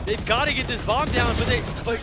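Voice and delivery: loud hyped esports caster; shouting. Real, not acted. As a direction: yell the call, fully hyped. Clutch final round call.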